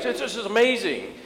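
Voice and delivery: high, amazed insistence; tearful awe. Retold or imitated. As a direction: retell an overwhelmed boy marveling through tears. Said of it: "tears rolling down his face".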